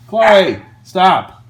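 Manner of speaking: loud sharp shout at a dog. Real, not acted. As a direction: yell to stop the barking. Yelling at barking dog.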